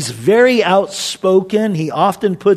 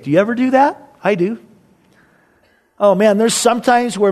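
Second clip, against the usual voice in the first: ordinary talk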